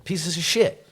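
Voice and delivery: sudden high spat-out insult; angry outburst. Real, not acted. Snapping at his ex's boyfriend, high angry outburst.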